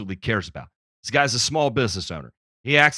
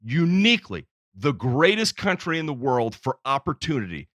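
louder, higher, fired-up speech; impassioned rant; 4 semitones higher, 5 dB louder than usual. Real, not acted. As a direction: build into a patriotic crescendo. Patriotic rant rising in force.

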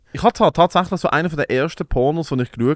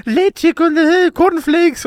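much higher, slow put-on woman's voice; hammed-up helpless plea for milk; mock pleading woman; 14 semitones higher, much slower than usual. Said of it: "sie so". (übertriebene hohe Frauenstimme, bittend)

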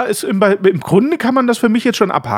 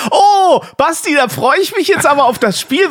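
übertrieben begeistert, lauter und höher; spöttisch übertriebene Vorfreude; gespielte Begeisterung; 7 semitones higher than usual. Pretend. Ironische Vorfreude auf Werbespiel. (gespielte Begeisterung)

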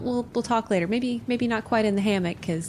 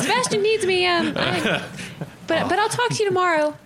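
flustered excuse; 11 semitones higher, 3 dB louder than usual. Acted. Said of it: "I'm just gonna go".

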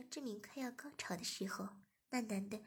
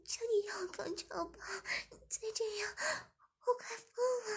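high, breathy desperate plea; high begging voice; 11 semitones higher, 3 dB louder than usual. A narrator's reading. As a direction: plead on the edge of breaking. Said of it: "忍不住的求饶道".

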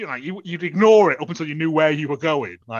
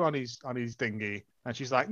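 ordinary delivery

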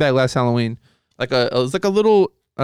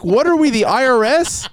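high, incredulous rant; half-laughing, fed-up disbelief; exasperated; 10 semitones higher than usual. Retold or imitated. Retold exasperated rant at family.

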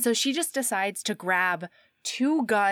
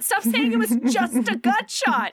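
high, exasperated outburst, hammed up; exasperated mock yell; 8 semitones higher, 4 dB louder than usual. Guilty woman snapping at her comforter, mock exasperation. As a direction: protest shrilly, building to a loud exclamation. Retold quarrel over the gut shot.

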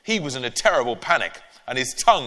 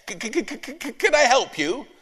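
high nervous stammer; 11 semitones higher than usual. Acted. Ben stammering nervously at the door to the neighbour. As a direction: trip over the first sound. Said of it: "spluttered Ben".

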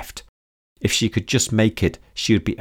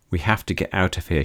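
ordinary delivery, nothing marked

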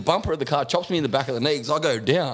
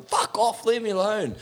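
retold yell; 10 semitones higher than usual. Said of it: "just fucking".

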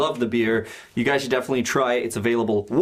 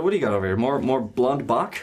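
usual speaking voice

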